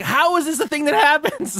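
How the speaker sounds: high incredulous cry, hammed up; mock outrage at the absurdity; incredulous disbelief. Narrator to friends, baffled by the cops: high incredulous disbelief. Retelling his own disbelief.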